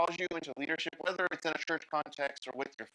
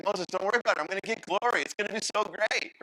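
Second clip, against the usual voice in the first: breezy God; 5 semitones higher, 5 dB louder, faster than usual. Pretend. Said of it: "God's like".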